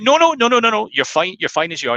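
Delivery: high, loud, rushed no-no-no that settles down; wife blocking the bathroom door in alarm; frantic wife. Acted. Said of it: "She's like".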